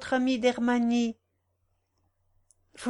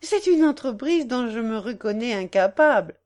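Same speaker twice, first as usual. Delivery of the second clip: louder, gaily protesting; cheerful exclamation; 2 semitones higher, 7 dB louder than usual. A narrator's reading. Young woman declines the matchmaking task, gaily. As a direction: cry it out with playful cheer. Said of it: "s'écria gaiement".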